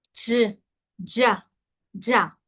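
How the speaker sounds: "ya" without a puff of air; same tongue spot as ch, no air; unaspirated Chinese sound. Shown as a demonstration. Teacher showing the no-air version of the sound. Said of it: "En esa misma posición, pero sin aire".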